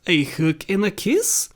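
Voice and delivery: ordinary delivery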